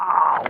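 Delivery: loud, sudden yelp of pain; sharp ow. Real, not acted. Blinded while setting the phone, sharp yelp. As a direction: yelp as it hurts.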